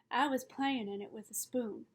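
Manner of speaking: ordinary delivery, nothing marked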